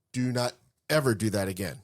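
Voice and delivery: normal talking voice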